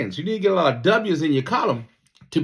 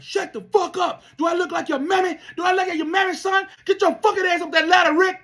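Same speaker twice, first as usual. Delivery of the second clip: mock drill instructor yell. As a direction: scream down a crying recruit. Drill instructor screaming at recruit.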